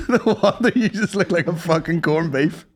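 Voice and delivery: normal talking voice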